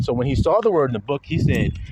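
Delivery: ordinary talk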